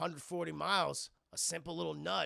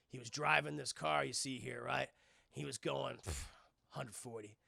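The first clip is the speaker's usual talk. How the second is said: low, sombre Dom; 5 semitones lower than usual. Acted. Dom telling Brian how his dad died, low and sombre. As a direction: confide a painful memory, low and heavy.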